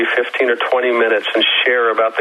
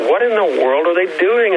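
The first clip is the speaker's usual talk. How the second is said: perplexed; 6 semitones higher than usual. Retold or imitated. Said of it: "I was a little perplexed".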